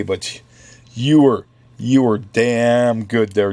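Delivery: ordinary delivery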